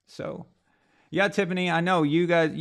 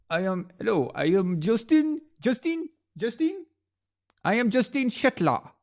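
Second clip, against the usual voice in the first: hammed-up French accent, pitched higher, Justin stretched out; mock French accent; 8 semitones higher than usual. Introducing himself as Justin in a hammed-up French accent. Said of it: "that's my best French impression".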